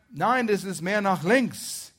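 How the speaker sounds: loud, high, slow shouted instruction; calling out. Acted. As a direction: call across a field. Acts out calling to arrow boy.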